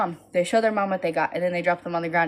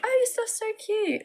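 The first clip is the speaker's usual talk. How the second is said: put-on high squeaky little girl gushing. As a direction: imitate a five-year-old girl cooing over toys. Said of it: "They're like".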